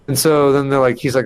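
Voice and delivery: usual speaking voice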